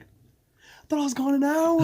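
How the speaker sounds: very high, loud mock disbelief; shocked friend. Imitating stoned friend after five minutes away, high shocked squeal.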